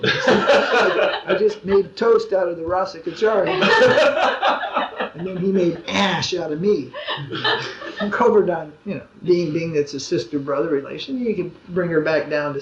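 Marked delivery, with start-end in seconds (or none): 0.0-6.9 talking through real laughter; laughing. Real, not acted. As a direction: break up laughing while telling the joke. Laughing through the retold joke.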